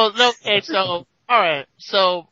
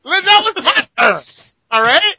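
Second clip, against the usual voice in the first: loud protest; 11 semitones higher, 5 dB louder than usual. Real, not acted. Cutting off an interruption.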